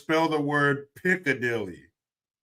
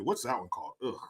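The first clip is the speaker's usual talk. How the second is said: ordinary talk, nothing marked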